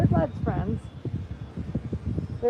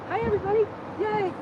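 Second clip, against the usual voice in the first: cooing hello; 12 semitones higher, faster than usual. Real, not acted. Greeting horses in baby voice.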